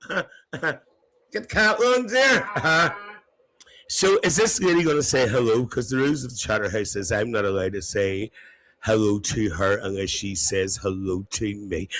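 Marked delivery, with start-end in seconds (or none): none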